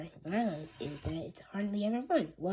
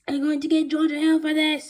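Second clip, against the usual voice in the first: loud, high furious yell; screaming; 9 semitones higher, 13 dB louder than usual. Acted. Principal vowing revenge on the boys, loud high yell. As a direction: scream the threat. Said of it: "screamed Mister Crow".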